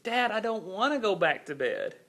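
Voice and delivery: a hint of a small boy pleading with dad; protesting young child. Voicing his protesting young son.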